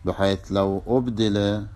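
normal talking voice

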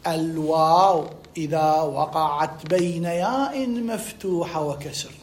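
very slow, measured rule recital; slow memorisation dictation. Shown as a demonstration. Teacher repeating a rule to be memorised, slow dictation. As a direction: draw each word out for memorising. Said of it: "احفظ لي، أكررها لك".

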